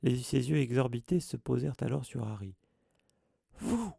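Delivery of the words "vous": high, shrill accusing shout; shrill cry. A narrator's reading. Caretaker accusing the boy, shrill high cry. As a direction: stab the word out, shrill and high. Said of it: "cria-t-il d'une voix stridente".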